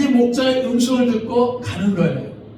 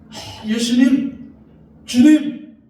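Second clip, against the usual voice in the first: slow, then a high, loud cry; Mary's cry; 5 semitones higher, 6 dB louder, much slower than usual. Acted. Mary meets risen Jesus, slow then high cry. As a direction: draw out the first words, then cry out. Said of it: "이렇게 지금 마리아가".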